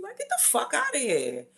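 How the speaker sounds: high-pitched mock protest; exasperated outburst. Woman telling a pushy dancer off, high-pitched exasperation. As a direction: shoot up high, fending someone off. Acts out reaction to grabby dancer.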